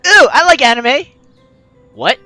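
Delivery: ordinary delivery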